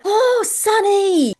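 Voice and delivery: high, loud delighted cry of a name; excited call. Retold or imitated. Stranger spotting her online idol across an open café, excited call. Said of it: "she stopped and she went".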